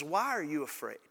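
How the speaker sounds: soft, hushed and tender; gentle question. Acted. Jesus to his scared disciples, soft hushed question. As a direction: ask it gently, like calming a frightened friend.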